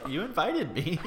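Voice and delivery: high-pitched quote through laughter; laughing retort. Retold or imitated. Retelling what she said to him, high and laughing. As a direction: break into laughs while imitating her. Acting out her reply, laughing.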